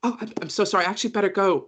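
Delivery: Ted hurrying off, sorry to leave; flustered apology. Acted. Ted turns to the party and apologizes, flustered, high. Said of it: "Ted turns to you and goes".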